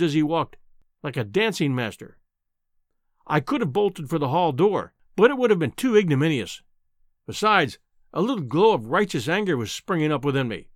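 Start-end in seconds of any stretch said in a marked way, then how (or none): none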